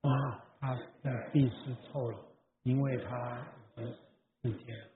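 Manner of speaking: quiet group recitation of scripture, far off; congregational scripture reading. Acted. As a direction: read the Bible aloud together softly. 会众一起朗读经文. (会众一同轻声朗读经文)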